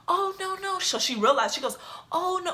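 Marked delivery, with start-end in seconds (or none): 0.1-0.8 high, squeaky mock innocence; high-pitched fake sweetness; mock backpedaling. Supervisor backtracks after grandma steps in. 2.1-2.5 high, squeaky mock innocence; high-pitched fake sweetness; mock backpedaling. Supervisor backtracks on realizing.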